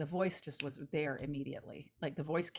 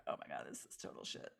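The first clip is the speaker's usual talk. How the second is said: very low, hushed aside to oneself; dejected self-talk; 11 dB quieter than usual. Acted. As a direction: drop way down and soft, as if despairing over your own draft. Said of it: "I just go through it feeling like".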